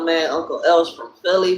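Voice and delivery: ordinary talk, nothing marked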